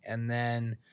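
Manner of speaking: ordinary talk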